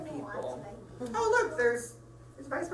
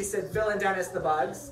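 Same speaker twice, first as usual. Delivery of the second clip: usual speaking voice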